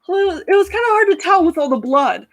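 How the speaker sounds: high, sheepish Skekvar voice; awkward blurt. Acted. Skekvar blurts grisly detail.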